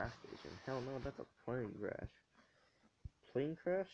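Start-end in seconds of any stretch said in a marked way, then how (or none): 3.3-3.9 high, loud excited call. Real, not acted. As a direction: shout it out in glee. Excited at loot.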